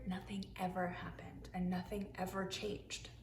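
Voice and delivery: dropped to a near whisper; soft storybook hush. A narrator's reading. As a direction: hush it like a secret. Storyteller hushes for dull desert.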